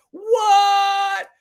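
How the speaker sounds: a cartoonish yelp of disbelief; mock shock. Mocking a viewer shocked he opposes all war, high shout.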